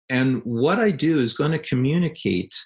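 ordinary delivery

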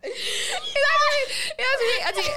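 high, loud laughing, nearly crying; laughing too hard to speak; helpless laughter. Real, not acted.

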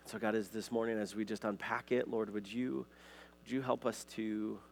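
low, soft, unhurried praying voice; prayer. Real, not acted. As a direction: speak softly to God, pitched well down. Leads an opening prayer.

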